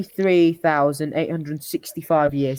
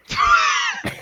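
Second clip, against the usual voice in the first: high squealing burst of laughter; shrieking laugh. Real, not acted. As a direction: let a laugh shoot up into a squeal. Laughs at own story.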